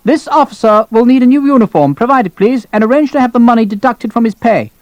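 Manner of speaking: high-pitched, imperious order; officious colonel. Acted. Colonel ordering major to re-uniform lieutenant, imperious.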